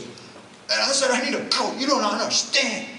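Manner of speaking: high-pitched, strained angry outburst; freaking out. Retold or imitated. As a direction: imitate a big man losing it. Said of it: "And then he freaked out. He slammed the table".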